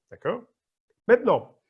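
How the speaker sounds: ordinary talk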